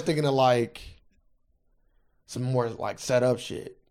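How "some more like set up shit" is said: ordinary delivery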